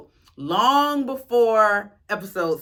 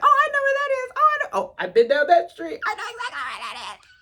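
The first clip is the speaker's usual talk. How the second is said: high-pitched excited squeal, laughing; gleeful high squeal breaking into laughter; 4 dB louder, much faster than usual. Retold or imitated. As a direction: burst out in giddy recognition. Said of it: "and I was excited 'cause I'm like".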